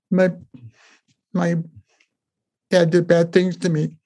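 high, slow, long broken pauses; retold painful disclosure, haltingly; halting confession. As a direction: imitate a hurt boy forcing out the words. Retelling a boy's painful disclosure.

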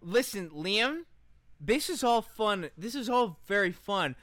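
ordinary talk

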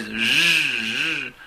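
drill buzz. Acted. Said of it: "imitating the drill".

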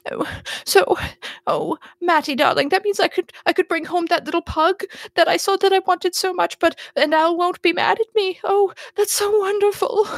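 high-pitched woman, acted relief after tears; sniffly Penelope. Dog owner hoping to bring home a pug, high and sniffly. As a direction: gush in a high, tremulous-hopeful voice. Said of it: "Penelope sniffles".

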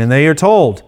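ordinary talk

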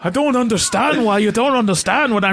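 high, exasperated mock British; terrible British accent. Co-host acting out a frustrated hero, in a terrible British accent. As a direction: plead in a hammed-up British accent. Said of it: "we have a terrible British accent".

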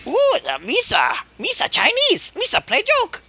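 high squeaky Jar Jar Binks impression. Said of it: "You all right, Jar Jar?".

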